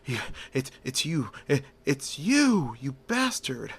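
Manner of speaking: stammering, panicky gangster; freaking out. Acted. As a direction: stutter in fear, face to face with Batman. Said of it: "He was freaking out".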